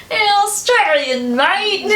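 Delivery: mock Australian accent joking with the director; jokey bad Australian accent. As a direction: ham up a lazy Australian accent for laughs. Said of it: "a really bad Australian accent yesterday for funsies".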